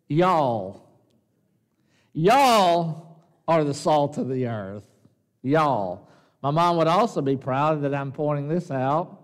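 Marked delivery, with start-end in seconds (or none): 0.1-6.1 slow Southern "y'all" with a long drawled vowel. Shown as a demonstration. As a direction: lean into the Southern twang on "Y'all". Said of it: "the southern of this is".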